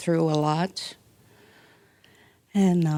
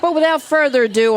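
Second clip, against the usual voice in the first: higher, louder and fast, a big hyped-up intro; excited announcing; 8 semitones higher, 7 dB louder, faster than usual. Shown as a demonstration. Pastor introducing the guest speaker, louder and higher with a burst of excitement. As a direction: relaunch the intro with a burst of energy. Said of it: "I don't know where that came from".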